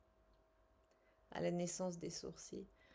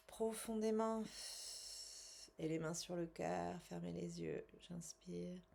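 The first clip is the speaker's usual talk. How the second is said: calm guided self-soothing script; guided relaxation; 4 dB quieter than usual. Acted. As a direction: talk the viewer gently through the tapping. Guided tapping relaxation session.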